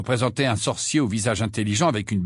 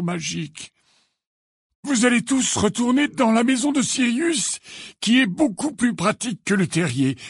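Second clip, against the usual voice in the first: high-pitched wizard headmaster; composed instructions, voice pitched up; Dumbledore, explaining; 9 semitones higher than usual. A narrator's reading. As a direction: explain firmly in a raised voice.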